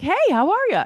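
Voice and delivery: high, loud, imitated chirpy hello; mock-cheerful greeting through a big grin; grinning, cheery greeting. Imitating him as he opens the door, grinning cheery greeting. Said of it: "grinning ear to ear".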